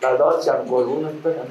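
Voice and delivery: normal talking voice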